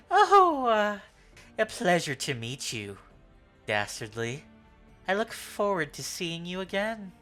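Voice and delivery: a hint of a smug trickster; light, playful charming farewell; lightly voiced suave rogue. NPC farewell in quotes.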